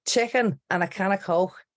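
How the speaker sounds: broad Scouse with scraped k sounds; Scouse accent. Shown as a demonstration. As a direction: stock Scouse catchphrase voice. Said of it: "they talk about a Scouse accent".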